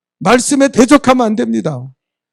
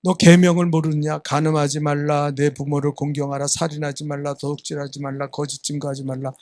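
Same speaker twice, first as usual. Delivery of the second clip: low, level listing of commandments, growing softer; solemn recitation; 6 semitones lower, 6 dB quieter than usual. Retold or imitated. Jesus reciting commandments to the rich young man, solemn and low. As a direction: intone the commandments as a teacher reciting them.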